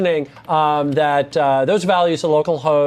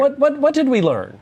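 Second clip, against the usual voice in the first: high host voice, repeated; game show host; 9 semitones higher than usual. Pretend. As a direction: play the eager quiz host.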